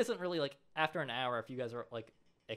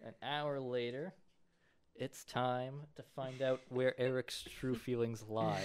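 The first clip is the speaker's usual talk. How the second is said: slow, drawn-out dramatic narration; suspenseful TV narrator, slow and deliberate; mock episode narrator; slower than usual. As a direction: tease the next episode, taking your time.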